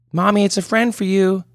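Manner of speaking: put-on little girl calling her mother; child calling mommy. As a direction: call out to mommy like a six-year-old.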